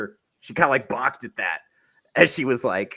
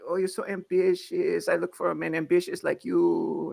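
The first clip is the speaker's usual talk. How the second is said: high mock Cuban accent, admiring; 5 semitones higher, 4 dB quieter than usual. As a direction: imitate a smitten Cuban stripper, pitched up. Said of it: "She was Cuban".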